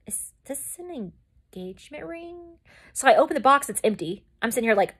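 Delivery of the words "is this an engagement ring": tiny awestruck voice; stunned hush. Retold or imitated. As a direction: barely get it out, rising in disbelief. Retells stunned discovery of ring box.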